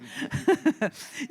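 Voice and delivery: sheepish giggle; embarrassed laugh. Real, not acted. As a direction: laugh off the fumbled name. Laughs at her own accent.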